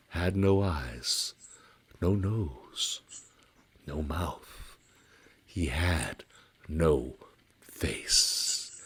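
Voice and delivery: slow, low and hushed, building dread; ominous hush. A narrator's reading. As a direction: let each word sink as the horror shows. Second faceless reveal.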